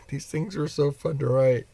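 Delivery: high, giddy between laughs; real amusement. Real, not acted. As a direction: say it tickled, on the edge of laughing. Laughs before and right after.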